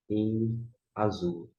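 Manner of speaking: ordinary talk, nothing marked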